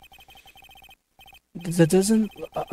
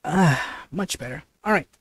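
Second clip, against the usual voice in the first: ordinary talk, nothing marked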